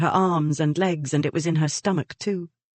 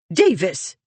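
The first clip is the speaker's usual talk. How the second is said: voice of authority; 3 semitones higher, 4 dB louder than usual. Acted. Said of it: "The voice of authority".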